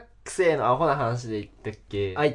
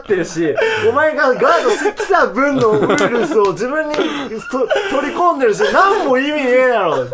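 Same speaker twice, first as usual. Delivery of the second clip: laughing mock scolding; 9 semitones higher, 9 dB louder than usual. Laughing rant at silly classmate.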